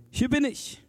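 high, ringing answer to a call; high-voiced reply of someone summoned; Samuel answering. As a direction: call back readily, pitched well up.

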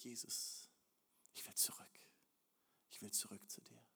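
soft whisper, longing to return; quiet inner whisper; whispered plea. Acted. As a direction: barely breathe it inward.

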